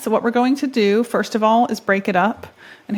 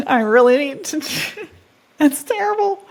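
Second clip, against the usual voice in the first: laughing at the clone; 6 semitones higher than usual. Real, not acted. Her clone video plays back badly.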